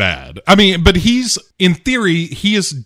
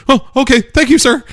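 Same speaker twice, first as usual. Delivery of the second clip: much higher, louder meek squeak; timid reply; 14 semitones higher, 4 dB louder than usual. Retold or imitated. Character answers his boss, high and flustered. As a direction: yelp it like a cowed underling. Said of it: "not Kyle Guest is like".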